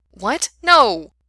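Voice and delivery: startled outburst rising to a loud, high shout; loud, appalled protest; shocked denial. Acted. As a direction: blurt it out, then yell in disbelief.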